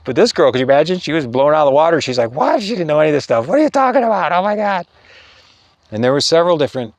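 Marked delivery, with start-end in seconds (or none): 2.3-4.9 shocked disbelief. Retold or imitated. Girl stunned by revelation.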